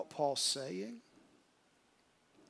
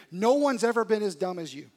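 much louder, higher taunting accuser; mocking devil; 4 semitones higher, 9 dB louder than usual. Satan the internet troll mocking the sinner, loud jeering taunts. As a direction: hammer the listener with cruel taunts. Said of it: "he starts whispering in your ear".

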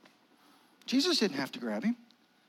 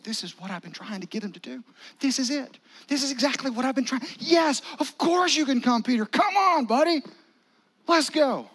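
excited Jesus; 6 semitones higher, 3 dB louder than usual. Acted. Jesus voiced as eager friend.

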